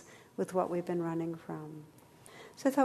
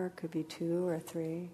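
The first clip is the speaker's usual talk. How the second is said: guided meditation; 3 dB quieter than usual. Acted. Leads a meditation.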